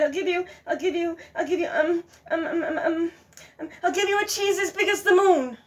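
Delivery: high, loud flustered stammer; nervous stalling. Acted. Goose tricking the fox, flustered and high.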